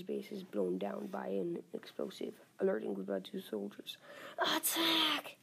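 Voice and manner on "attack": yelling. Acted. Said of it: "yells Steve".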